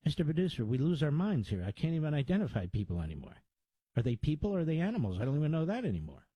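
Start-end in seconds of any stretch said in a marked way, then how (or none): 4.0-6.3 rushed rant. Real, not acted. Host rants rapidly.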